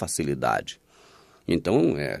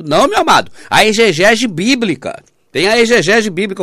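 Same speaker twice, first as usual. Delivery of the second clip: mocking the critics; 7 semitones higher, 6 dB louder than usual. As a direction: sneer it out as a know-it-all. Mimicking critics' reply.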